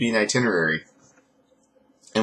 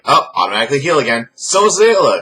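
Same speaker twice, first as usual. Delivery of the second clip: sarcastic; 6 semitones higher, 6 dB louder, faster than usual. Retold or imitated. Mocking the show's logic.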